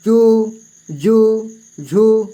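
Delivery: "चू, जू, झू" drawn out slowly one by one; slow syllable demo. Shown as a demonstration. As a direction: spell out each syllable slowly for the class. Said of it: "इस तरह से". ("चू, जू, झू" धीरे-धीरे, एक-एक अक्षर अलग करके)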